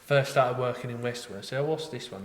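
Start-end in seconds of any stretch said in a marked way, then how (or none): none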